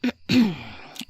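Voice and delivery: deliberate clearing of the throat; pointed little ahem. Acted. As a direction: clear your throat to change the subject. Collecting herself before the welcome.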